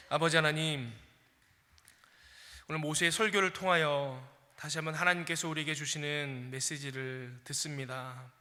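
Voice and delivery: soft, low, unhurried prayer voice; closing prayer. Acted. Pastor leading the congregation in prayer, hushed and low. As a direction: pray quietly to the Father.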